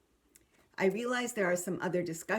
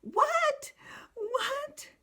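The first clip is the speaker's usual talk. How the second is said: put-on much higher, crying child's bewildered What?; confused crying child; 4 dB louder than usual. Child crying at a strange answer, much higher bewildered wail. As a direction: wail it through tears, lost and baffled. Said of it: "And the child says".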